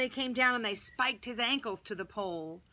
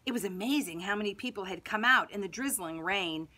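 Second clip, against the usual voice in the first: ordinary delivery, nothing marked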